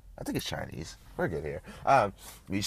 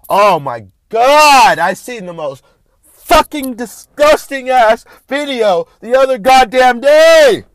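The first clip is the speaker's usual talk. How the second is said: loud disgust; 12 semitones higher, 14 dB louder than usual. Real, not acted. Host recalling a disgusting video, loudly appalled. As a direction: exclaim it, appalled and worked up.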